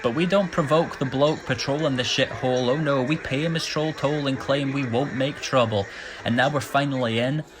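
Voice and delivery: ordinary delivery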